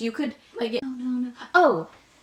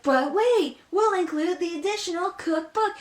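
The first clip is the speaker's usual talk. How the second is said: infomercial announcer; 9 semitones higher than usual. Retold or imitated. Mimicking the cake pan ad's extra offer, hyped announcer. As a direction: hype the extra bonus like a TV ad. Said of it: "then it's like".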